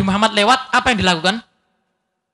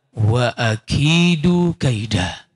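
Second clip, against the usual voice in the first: very slow, lower, intoned recitation; Quranic recitation; 8 semitones lower, much slower than usual. Acted. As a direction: draw the Arabic out like reciting scripture. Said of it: "Tapi Allah menyatakan".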